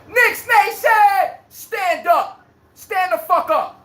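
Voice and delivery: hyped slow rally cry; rallying call-out. Real, not acted. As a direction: summon Knicks Nation to rise. Calling out the rival fans.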